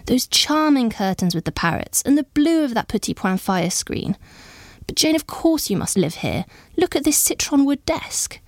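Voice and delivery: lightly voiced gushing admiration; admiring exclamations. A narrator's reading. Visitors admiring the blue room to Jane, exclaiming. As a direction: exclaim over the furnishings. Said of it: "echoed with exclamations".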